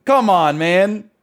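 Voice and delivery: exasperated. Real, not acted. Scolding the takeaway plate.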